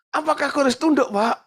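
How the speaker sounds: high mock-whiny voice of a reluctant wife; imitating a complaining wife. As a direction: mimic the wife bargaining with the pastor. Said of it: "Banyak perempuan tuh ngomongnya begitu".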